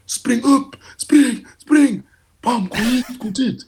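rapped-out orders, imitating a player shouting; imitated shouting. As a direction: call out runs like a player on the pitch. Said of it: "han skrek".

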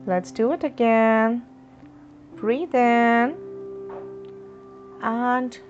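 ordinary delivery

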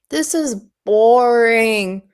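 slow, drawn-out groaning complaint; bored moan. Acted. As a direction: kreun het verveeld en langzaam. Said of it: "Dudley moaned".

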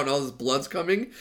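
ordinary talk, nothing marked

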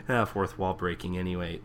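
ordinary delivery

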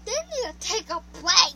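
a dad calling out loud. Retold or imitated. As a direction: shout it like a fed-up dad. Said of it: "and then my dad, like".

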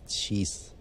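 breathy whispered sigh of dismay; whispered jeez. Real, not acted. Worrying aloud to Twitter about his marriage, whispered. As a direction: barely breathe it out, defeated.

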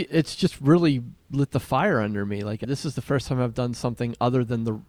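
ordinary talk, nothing marked

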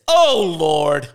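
a loud, fed-up "Oh, Lord"; exasperated sigh. Retold or imitated. Student fed up with the professor's mistakes, loud exasperated groan.

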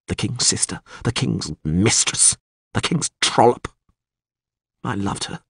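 lightly voiced anguished captive lord; a hint of bitterness, pitch lifting in places; captive lord confessing. A narrator's reading. Bound lord confesses his love to the witcher, mildly anguished.

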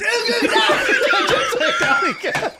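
very high shout through laughter; piercingly high mock yell, cracking up; hammed-up cry. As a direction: imitate a recruit yelping out his call. Recruit's cry retold amid laughter.

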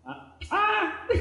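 high-pitched exclaimed "ah" with laughter; high mock cry. Preacher joking about revenge on the brothers, high mock cry. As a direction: yelp it high, half laughing.

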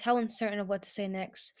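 ordinary delivery, nothing marked

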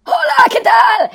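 slow, loud mock shout; annoying client voice. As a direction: ham up the pesky client. Caller plays the annoying client.

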